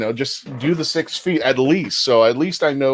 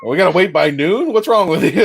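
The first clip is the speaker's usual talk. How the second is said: laughing, loud mock protest; laughing outrage; 3 semitones higher, 5 dB louder than usual. Real, not acted.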